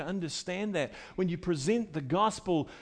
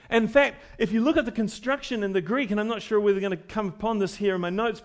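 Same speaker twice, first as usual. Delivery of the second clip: ordinary talk, nothing marked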